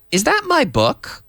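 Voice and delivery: high question "Is that my book?"; question tune. Shown as a demonstration. Teacher modelling the question for students.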